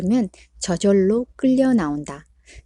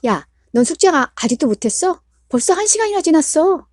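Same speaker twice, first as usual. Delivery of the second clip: higher, loud nagging; irritated scolding; 8 semitones higher, 5 dB louder than usual. Acted. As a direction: vent the frustration on the son. Irritated parent scolding son.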